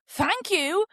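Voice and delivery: mock-polite, sarcastic retort. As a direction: throw the thanks back sarcastically. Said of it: "I did kind of sarcastically say".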